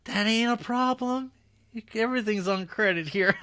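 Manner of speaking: high, hammed-up folksy shopkeeper; folksy Lincoln voice.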